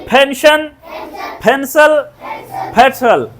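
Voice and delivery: hard puff of air on the first P; crisp model words with aspirated P; aspirated English P. Shown as a demonstration. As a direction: drill P as a breathy "ph" in फेंशन, फेंसल, फेडरल. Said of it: "फ बोलो इसको".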